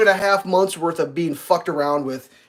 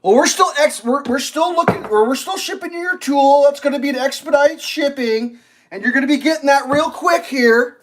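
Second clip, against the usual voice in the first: high, louder chipper agent; hammed-up customer service voice; mock rep voice; 9 semitones higher, 5 dB louder than usual. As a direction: play the clueless cheerful rep. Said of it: "they're like".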